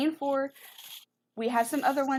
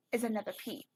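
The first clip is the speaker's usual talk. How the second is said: ordinary delivery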